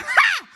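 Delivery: loud high cackle; burst of laughter. Real, not acted. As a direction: crack up for real. Cracking up at the memory.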